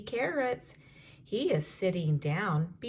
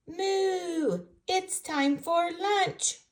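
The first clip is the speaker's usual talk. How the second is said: higher cow voice, slow; calf's mother lowing for lunch; mooing cow; 10 semitones higher, 3 dB louder, slower than usual. Acted. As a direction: moo it out like a cheerful cow calling for lunch.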